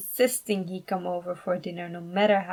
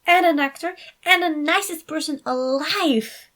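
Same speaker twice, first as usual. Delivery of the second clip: gushing niece; 8 semitones higher, 6 dB louder than usual. A narrator's reading. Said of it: "Carla explained".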